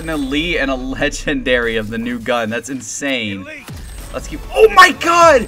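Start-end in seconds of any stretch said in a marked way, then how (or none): 4.1-5.5 sudden high, loud scream; shocked excitement. Real, not acted. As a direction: explode in real disbelief. Pulls legendary mid-sentence.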